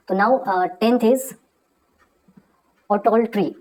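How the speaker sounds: usual speaking voice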